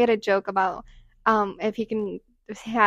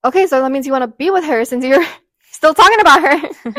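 put-on louder, accusing girlfriend jealousy; pretend-jealous rant at a guy; mock jealous; 3 semitones higher, 8 dB louder than usual. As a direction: play the jealous girlfriend, half-joking. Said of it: "acting jealous".

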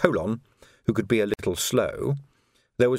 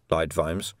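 plain reading voice

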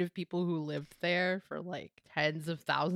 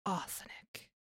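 whispering. Acted. Murder mystery roleplay, in character.

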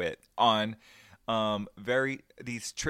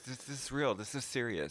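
mock serious; 9 dB quieter than usual. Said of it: "There's no jokes".

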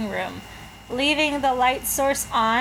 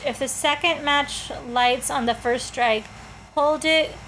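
normal talking voice

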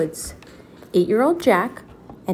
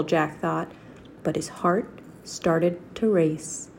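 plain reading voice

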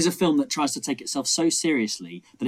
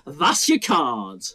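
higher, louder, very slow mock insistence; insistent magician; 7 semitones higher, 4 dB louder, much slower than usual. Magician insisting on the wrong card, mock-forceful. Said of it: "And they go".

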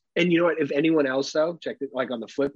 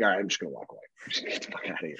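whispered aside; 6 semitones lower, 7 dB quieter, much faster than usual. Retold or imitated. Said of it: "It's like".

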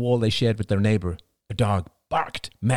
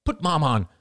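cried out; 9 semitones higher than usual. A narrator's reading. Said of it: "cried Cassie".

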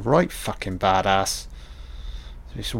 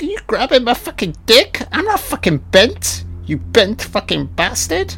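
much higher, indignant thug voice; outraged Snow; 11 semitones higher than usual. Retold or imitated. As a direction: pitch it way up, offended. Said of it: "Snow's like".

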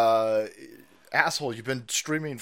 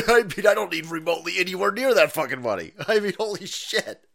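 laughing rant; 3 semitones higher, 7 dB louder than usual. Real, not acted. Ranting about not needing money.

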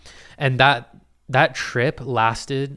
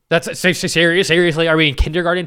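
higher, louder mock outrage; exasperated; 5 semitones higher, 7 dB louder than usual. Real, not acted. Viewer asks if he sniffs butts: loud exasperated disbelief.

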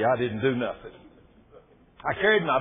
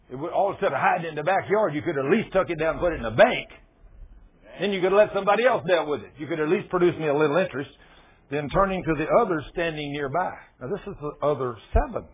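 ordinary talk, nothing marked

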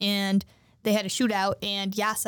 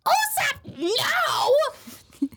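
very high, hammed-up yell; mock-panicked high outburst; alarmed cry; 3 dB louder, much slower than usual. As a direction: shriek it like a cartoon girl stopping a friend. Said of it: "she's like".

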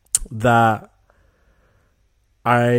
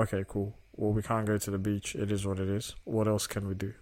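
resigned shrug; 3 semitones lower, 9 dB quieter than usual. Pretend. Shrugging off rained-out beach day.

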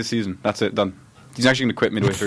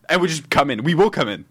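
normal talking voice

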